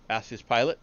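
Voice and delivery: usual speaking voice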